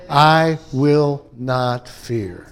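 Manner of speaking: very slow, each word set apart; measured, resolute delivery with long spaced words; firm declaration. Shown as a demonstration. Models a firm declaration of faith.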